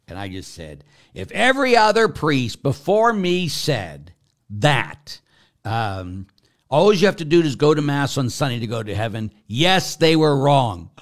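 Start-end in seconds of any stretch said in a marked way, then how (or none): 1.1-10.9 fed-up retort. Retold or imitated. New pastor finally losing patience with the caller, loud. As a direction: lay down the final word, exasperated. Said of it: "I had it".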